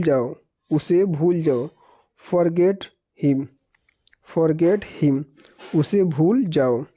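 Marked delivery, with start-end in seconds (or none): none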